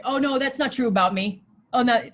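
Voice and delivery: quick mock denial of a kind word; acted refusal. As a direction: act out spitting the praise back. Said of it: "Or you might spit it out".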